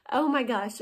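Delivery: usual speaking voice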